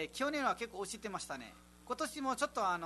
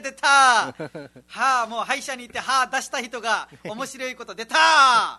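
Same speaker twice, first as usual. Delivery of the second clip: high, booming teasing shout; loud mock outburst; 5 semitones higher, 15 dB louder than usual. Co-host jeering at the host's joke, loud mock outburst. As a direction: heckle at full volume, drawing out the last word.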